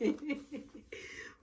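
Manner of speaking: high chuckle; real chuckle. Real, not acted. She laughs at everybody refusing, high chuckle. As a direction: chuckle at your own retelling.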